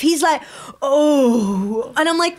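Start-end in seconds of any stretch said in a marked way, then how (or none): 0.8-1.9 drawn-out mock moan of pleasure; hammed-up sexual moan; imitated man's moan. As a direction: imitate an overheard man moaning. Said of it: "A man moaning".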